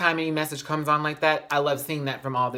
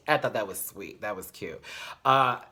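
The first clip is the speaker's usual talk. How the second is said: normal talking voice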